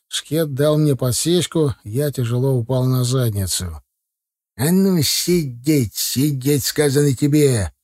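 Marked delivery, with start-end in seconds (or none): 4.6-7.7 gruff thug. Acted. Bandits shout at fallen narrator.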